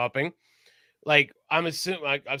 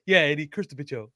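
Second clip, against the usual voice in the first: Eddie Murphy quote. Retold or imitated. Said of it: "Eddie Murphy when Ricky's cursing".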